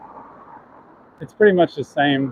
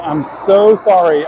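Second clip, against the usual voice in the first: usual speaking voice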